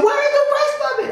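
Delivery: high shocked squeak; shocked girlfriend. Retold or imitated. Mimicking his girlfriend's high shocked question.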